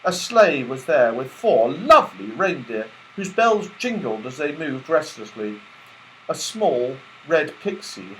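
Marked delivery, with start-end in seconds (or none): none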